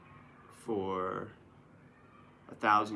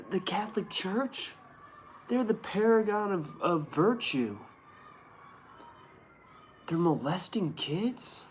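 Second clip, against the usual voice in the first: higher pitched, faux-innocent questions; mock disbelief; 7 semitones higher than usual. Host mocks the church's virtue with feigned surprise, higher. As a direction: play dumb about the obvious.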